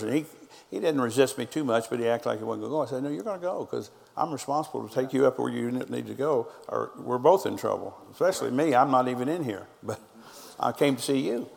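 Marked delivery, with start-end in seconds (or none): none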